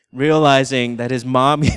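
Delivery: ordinary talk, nothing marked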